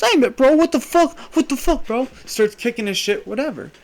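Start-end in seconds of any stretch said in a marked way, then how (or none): 0.0-2.1 high, loud, outraged bro; angry bro yell. Retold or imitated. Man yelling at the bully who grabbed his shoes. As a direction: yell it like a guy robbed mid-toilet. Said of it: "He goes".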